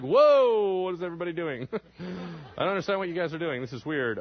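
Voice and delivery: bewildered, starting high and loud, then settling; baffled preacher with a startled whoa; caught off guard. Acted. Preacher watching his congregation freak out, taken aback. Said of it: "he's totally caught off guard".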